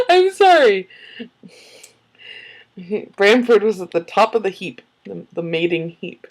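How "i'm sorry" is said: giggling sorry, higher and louder; laughing apology. Real, not acted.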